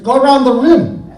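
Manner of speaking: booming fake Icelander; fake Icelandic accent. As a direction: declare it in a put-on Icelandic accent. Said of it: "in my best fake I-, Icelandic accent".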